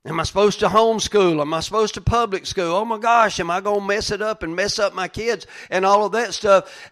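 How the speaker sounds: high, loud fretting; agitated run of self-doubting questions; self-judging. Acted. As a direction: worry aloud like an overwhelmed parent. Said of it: "You're always judging yourself".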